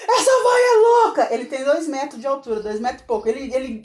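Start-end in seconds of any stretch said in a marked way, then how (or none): 0.1-1.3 high, loud and slow laughing yell; friend's shout. Retold or imitated. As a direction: bellow it like a tall friend cracking up. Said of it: "ele falava assim".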